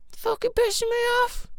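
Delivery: high, strained mock stressy parent; stressed parent. Parent fed up with the kids, high mock stressy whine. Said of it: "Getting stressy at the kids".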